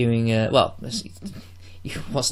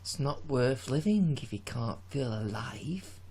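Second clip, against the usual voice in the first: quiet dramatic line; 10 dB quieter, slower than usual. Pretend. Quoting Bond movie line.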